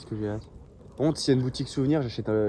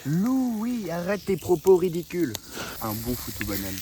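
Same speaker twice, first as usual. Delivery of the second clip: higher, odd put-on scold; 5 semitones higher, slower than usual. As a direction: scold like a strict elder.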